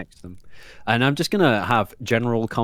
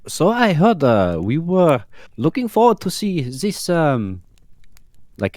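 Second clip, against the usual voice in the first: in character; 6 semitones higher, 4 dB louder, slower than usual. Acted. Player in character fishes for information.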